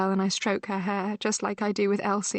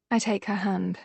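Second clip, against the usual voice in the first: ordinary delivery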